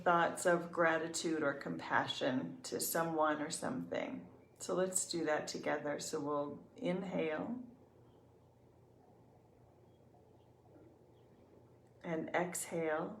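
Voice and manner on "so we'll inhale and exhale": soft, unhurried meditation voice with long pauses; calm, even guidance, leaving room to breathe; guided meditation. Real, not acted.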